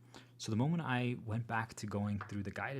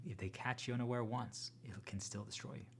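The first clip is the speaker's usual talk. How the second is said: ordinary talk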